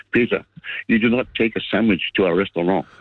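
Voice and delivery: mock-French punchline delivery; imitated French accent. Caller quoting a French footballer's punchline, imitated French accent. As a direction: land the joke in a put-on French accent. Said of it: "a French guy come over".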